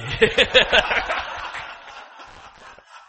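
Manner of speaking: loud breathy laughter; hearty laugh. Real, not acted. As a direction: let the laugh roll out. Laughs at own joke.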